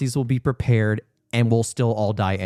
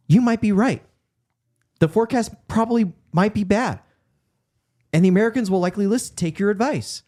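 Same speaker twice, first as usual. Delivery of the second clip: higher-pitched, deliberate persuasion; acted earnest concession; 8 semitones higher than usual. Montgomery conceding to the forecaster, earnest raised pitch. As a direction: lift the pitch and reason it out phrase by phrase. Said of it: "It's like, listen".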